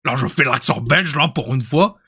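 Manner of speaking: mock Belgian French; Belgian accent. Streamer imitating someone's Belgian act, Belgian accent. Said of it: "il a fait l'accent".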